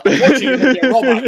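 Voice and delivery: laughing through the words. Real, not acted. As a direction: say it while cracking up. Laughs while answering joke.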